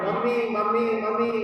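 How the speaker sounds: lightly acted child calling for mother; a mild imitation of a pestering child. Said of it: "seek the attention of the mother by calling out".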